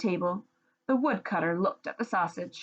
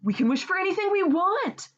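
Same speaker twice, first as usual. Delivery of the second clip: wife's voice; 11 semitones higher, 4 dB louder than usual. Acted. Wife voiced in read-aloud story.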